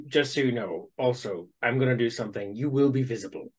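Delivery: low, softened aside to an ally; quietly tip off a comrade, in character; lightly acted undertone; hushed in-character warning. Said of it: "Bowman's gonna whisper, uh, t-, say to Nyx".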